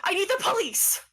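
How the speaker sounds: high-pitched frantic demand; desperate plea. Acted. Cosmo begs the stranger for the police, desperate. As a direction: beg frantically, voice shooting up.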